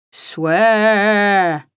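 loud, long-drawn spectral wail; one sustained, resonant ghostly command; booming unearthly ghost. A narrator's reading. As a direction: boom it out like a voice from hell. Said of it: "boomed an unearthly voice, resonant with the terrors of hell".

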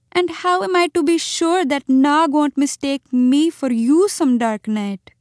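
high, sorrowful fretting; Chuchundar. A narrator's reading. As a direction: whine anxiously, slowing towards the end. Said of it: "more sorrowfully than ever".

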